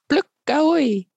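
high, drawn-out farewell cry; loud bird cry. A narrator's reading. Bird calls goodbye to Pluk before flying off, loud cry. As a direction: call out long and high. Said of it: "met een harde schreeuw".

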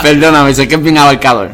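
loud, amused apology; laughing apology. Real, not acted. Laughing apology for broad question.

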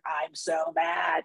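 slow, drawn-out mock rage, half yelled; body's mock fury. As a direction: let the body sulk it out, stretched and grumbling. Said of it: "my body is like".

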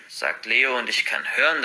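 plain reading voice

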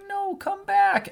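put-on high, slow child's plea calling after them; little Gaara pleading. Little Gaara begs the kids who ran away, high and slow. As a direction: stretch it out like a lonely small boy begging.

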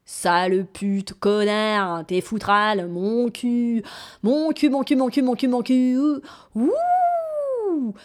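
loud, rising string of curses ending in a high hoot; foul-mouthed rag doll shouting insults; doll's swearing tirade. Acted. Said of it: "se met à débiter un chapelet d'horribles gros mots".

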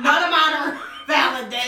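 loud laughing protest. Real, not acted. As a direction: blurt it out through laughter. Laughing dismay at rule.

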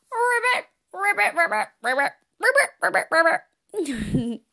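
ribbit like a playful little frog. Shown as a demonstration. Host leading kids' breathing with playful frog ribbits. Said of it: "two more times".

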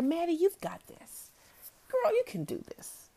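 put-on high, bright pep talk to a child; upbeat encouragement. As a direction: cheer her on like a coach. Aunt cheering on her niece.

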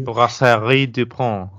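Brasserie DuPont said the French way, slow; French accent. Shown as a demonstration. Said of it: "I put on my, my best French accent for that".

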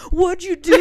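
loud, accusing yell; mock shout. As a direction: blurt it out loud and high. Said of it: "and he goes".

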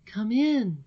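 high, airy summons; princess's voice. Acted. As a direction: bid a visitor enter in a high voice. Voices the princess, second take.

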